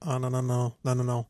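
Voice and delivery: soft, low, muttered worried nos; mild inner panic. Retold or imitated. Told he must move the car, soft worried nos. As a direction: quietly dread it under the breath. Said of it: "Là, j'étais là".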